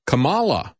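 "Kamala" stressed on the middle syllable, kuh-MAH-luh. Shown as a demonstration. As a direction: hit MA in "Kamala". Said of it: "as I've always pronounced it".

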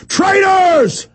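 high shouted accusation; shouting traitors. Real, not acted. Host raging at the government mid-call, loud shout. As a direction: hurl the word at them. Ranting at the Pentagon.

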